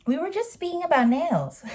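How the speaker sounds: high mocking imitation of the friend; mocking her friend. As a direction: read her message in a snide high voice. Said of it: "she says something like".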